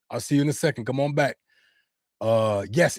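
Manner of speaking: ordinary delivery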